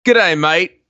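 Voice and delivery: lightly mock Australian "G'day, mate"; Australian accent. Podcast co-host jokingly greets the host in a mock Australian accent. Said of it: "not doing the whole episode in an Australian accent".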